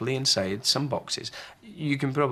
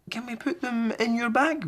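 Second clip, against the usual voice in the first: put-on high, curious boy voice; a child fishing for a surprise; high voice, eager question; young boy Harry. Harry asks Granny.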